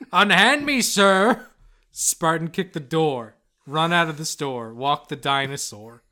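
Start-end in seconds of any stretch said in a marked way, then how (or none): 0.1-1.5 theatrical indignant cry. Acted. Plague doctor fending off manager.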